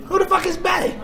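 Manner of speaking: outraged dad shouting; shrill angry shout. Retold or imitated. Father reading daughter's phone.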